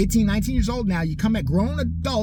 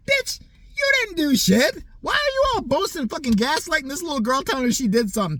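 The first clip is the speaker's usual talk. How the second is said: furious rant; 8 semitones higher than usual. Real, not acted. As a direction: blow up in disbelief at the fans. Ranting at the audience.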